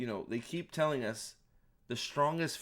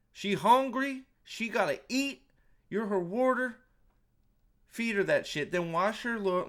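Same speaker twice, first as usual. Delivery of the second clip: heated rant; 9 semitones higher, 5 dB louder than usual. Real, not acted. Ranting at Lan about Moiraine.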